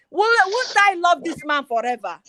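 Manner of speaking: loud, tearful delight at her husband's kindness; overcome with joy. Real, not acted. Wife telling listeners about her husband, loud and teary. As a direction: let the joy crack into tears. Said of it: "cries with emotion".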